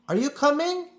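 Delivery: a touch of a brisk conductor; conductor asking. A narrator's reading. Conductor asks the boy in pajamas, lightly voiced. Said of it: "he said".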